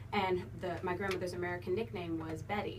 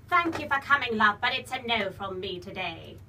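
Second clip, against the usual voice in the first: British judge voice, louder; posh British TV judge letting you down; Sharon Osbourne voice; 7 dB louder than usual. Shown as a demonstration. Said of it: "I do a Sharon Osbourne".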